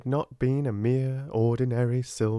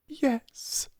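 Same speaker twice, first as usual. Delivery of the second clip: high, impassioned admission; passionate. A narrator's reading. Sarah finally admitting it to her friend, passionate. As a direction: let it out with passion. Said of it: "she said in a new passionate way".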